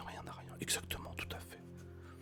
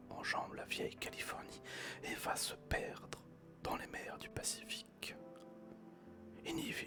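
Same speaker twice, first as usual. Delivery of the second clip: whispered story reading; 11 semitones lower than usual. Acted. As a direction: read the story gently in a whisper. Reads Jules Verne aloud whispering.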